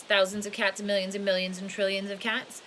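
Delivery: ordinary delivery, nothing marked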